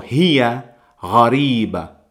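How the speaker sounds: Huwa gharib slow and careful in Arabic; Arabic phrase. Shown as a demonstration. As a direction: model the Arabic phrase for learners. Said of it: "podemos decir".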